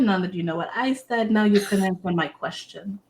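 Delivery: usual speaking voice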